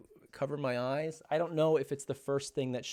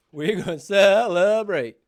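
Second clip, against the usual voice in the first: cheering; 5 semitones higher, 9 dB louder than usual. Real, not acted. Dad repeats it bigger.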